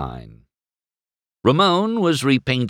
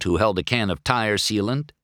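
ordinary delivery

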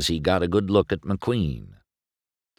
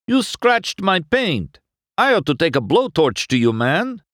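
high, raised-voice complaint; angry lowrider; 12 semitones higher, 4 dB louder than usual. A narrator's reading. Lowrider whose paint got scratched, indignant outburst. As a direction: threaten him, outraged about your paint.

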